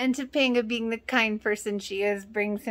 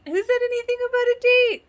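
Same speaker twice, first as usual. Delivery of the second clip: much higher, louder, rushed disbelief; panicked Corey; 7 dB louder, much faster than usual. Retold or imitated. Viewer imitating Corey panicking over the word date, higher and rushed. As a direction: squeak it out in alarm.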